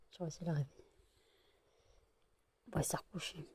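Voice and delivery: hushed whisper; whispering over a crib. Real, not acted. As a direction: whisper so the dogs stay asleep. Whispering near sleeping dogs.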